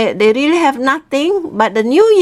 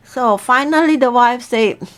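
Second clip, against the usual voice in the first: ordinary delivery, nothing marked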